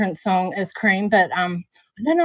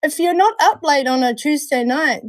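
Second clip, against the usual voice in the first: ordinary talk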